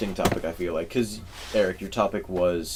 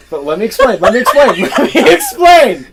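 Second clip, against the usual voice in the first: building plea; 13 semitones higher, 15 dB louder than usual. Real, not acted. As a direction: pitch climbing higher and louder, into a laugh. Repeated plea, rising, laughing.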